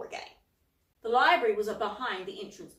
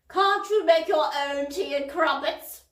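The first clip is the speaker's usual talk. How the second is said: stern, louder rebuke; sternly; 6 semitones higher, 8 dB louder than usual. A narrator's reading. Miss Polwarth rebuking Cauldron, stern. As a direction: scold him firmly. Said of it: "asked Miss Polwarth sternly".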